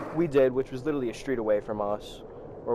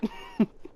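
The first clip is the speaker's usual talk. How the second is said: short voiceless chuckle; stifled laugh; 4 dB quieter than usual. Real, not acted. Amused at the crude comeback he retold, real chuckle. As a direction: snicker under the breath.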